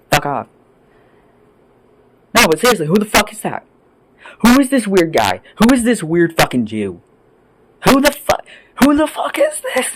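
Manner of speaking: usual speaking voice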